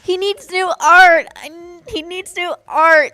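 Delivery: loud, high-pitched pleading complaint; emphatic complaint. Real, not acted. Co-caster demands new art for Twisted Fate, worked up. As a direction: cry out for a fix, pitched high. Mock outrage over character's dated art.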